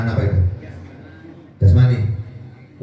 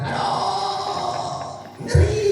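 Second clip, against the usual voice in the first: hammed-up labour wail leaping very high; mock groan rising to a high squeal; imitated pain cries; 6 dB quieter, much slower than usual. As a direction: mimic a mother crying out in childbirth. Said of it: "Sampai teriak-teriak".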